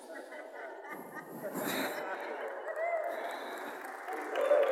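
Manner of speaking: a long airy laugh of joy; a preacher laughing with relief before praising God; long breathless laugh. Real, not acted. Joyful release after healing story.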